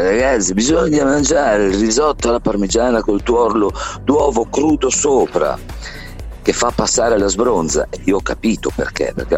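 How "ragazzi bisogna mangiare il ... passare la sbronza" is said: raised pitch, lightly voiced buddy; a hint of friendly late-night advice; imitating Vasco. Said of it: "lui diceva sempre".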